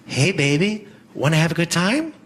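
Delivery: lightly voiced sultry come-on; hooker come-on. Hooker propositioning a superhero, lightly sultry. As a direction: tempt him, a hint of a hooker. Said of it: "This is my impression of".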